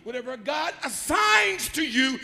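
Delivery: loud, high, emphatic sermon delivery; shouted preaching with stretched stressed words; full-force preaching. Real, not acted. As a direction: drive each phrase home to the congregation.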